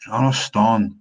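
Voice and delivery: loud Scots "Understand" with the consonants swallowed; Scots pronunciation. Shown as a demonstration. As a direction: say "Understand" as broad Scots "unnerstaun". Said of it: "is pronounced slightly differently".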